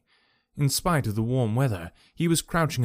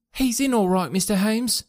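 put-on high, eager street boy; street urchin; 9 semitones higher than usual. A narrator's reading. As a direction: pitch it up like a breathless street kid.

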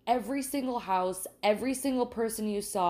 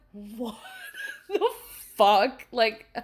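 laughing disbelief; 2 semitones higher, 4 dB louder than usual. Real, not acted. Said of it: "strange hearing you talk like that".

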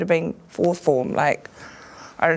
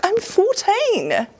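much higher, slow, pleading whine; 14 semitones higher, much slower than usual. Retold or imitated. Teen in mascot suit answering insults, high slow plea. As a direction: stretch it out high, like a wounded kid.

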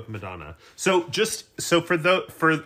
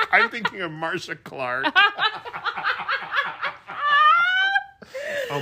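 laughing. Real, not acted. Laughing at own mix-up.

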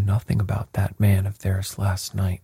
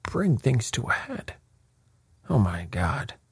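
high, softer incredulous echo, falling away; aghast cry; 4 semitones higher, 4 dB quieter than usual. A narrator's reading. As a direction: echo it back in disbelief, without shouting. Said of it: "cried Lord Yardley".

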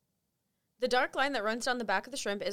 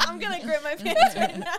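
loud, laughing joke; 8 semitones higher, 8 dB louder than usual. Real, not acted. Joking to friends, laughing loudly. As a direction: blurt it out through a laugh.

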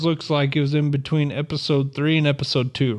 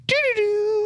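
very high little doo-doo-doo sting; imitated jingle; slower than usual. Mimicking how an episode abruptly ends, high sung jingle.

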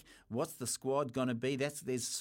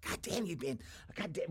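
ordinary talk

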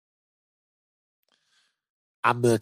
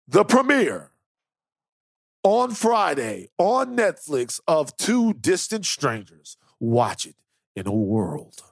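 movie trailer voice. Acted. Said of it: "There it is, Trailer Voice Van".

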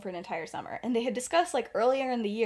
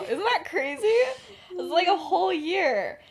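excited; 7 semitones higher, 5 dB louder than usual. Acted. Remus marvels at a year together.

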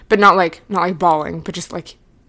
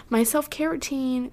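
higher, playful repeat of self-care routine; playful sing-song echo; 6 semitones higher, 4 dB quieter than usual. Pretend. Repeating her own words to viewers in a higher teasing voice. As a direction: toss the words back up brightly.

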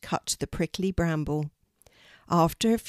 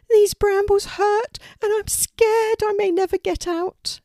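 very high, loud, frightened squeal; pleading; 6 dB louder than usual. A narrator's reading. Trapped Poppy fears she is stuck, high pleading. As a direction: plead in a high, scared bird voice. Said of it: "pleaded Poppy".

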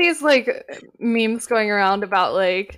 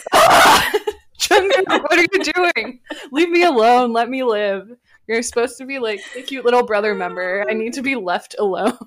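squealing, flustered cute aggression; overwhelmed by cuteness; 3 semitones higher, 6 dB louder than usual. Retold or imitated. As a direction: gush like it's too cute to bear. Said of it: "cute aggression thing".

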